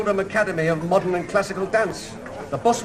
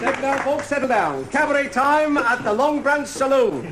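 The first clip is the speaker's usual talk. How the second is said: showman announcer; 5 semitones higher than usual. Acted. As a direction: sell it like a saloon compère. Compère announces the cabaret.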